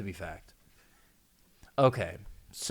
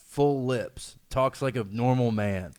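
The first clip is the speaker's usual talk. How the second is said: ordinary delivery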